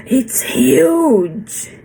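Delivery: "huge" long and falling in a mock Australian tune; Australian intonation. Teacher imitates Terry's Australian way of saying huge. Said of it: "sempre com uma entonação".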